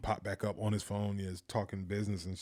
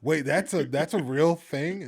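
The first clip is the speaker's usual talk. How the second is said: amazed; 6 semitones higher, 8 dB louder, slower than usual. Retold or imitated. Retold surprise at shrimp on the barbie.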